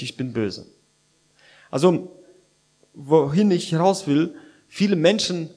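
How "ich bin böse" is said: low and soft, settled; quiet resolve. Retold or imitated. Preacher voicing a person deciding for sin, quiet and settled. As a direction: say it quietly, as a calm decision. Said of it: "entscheidet sich".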